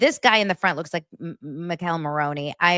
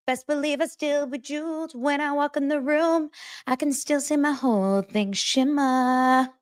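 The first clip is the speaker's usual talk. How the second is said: mock [name]; 10 semitones higher than usual. Taylor answering grumpy Travis with a lilting brush-off. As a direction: breezily brush him off. Voicing Taylor replying brightly.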